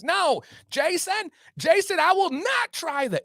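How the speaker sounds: high-pitched, stretched-out exasperated wife; mock arguing. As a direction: imitate a wife refusing her husband in a squabble. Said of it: "immediately they start arguing loudly".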